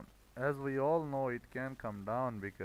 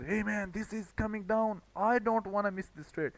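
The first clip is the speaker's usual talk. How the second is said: higher, mocking voice of an eager rookie trader; 6 semitones higher, 3 dB louder than usual. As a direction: lightly parody a greedy newbie afraid to miss out. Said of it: "some people may, may place their sell stop there".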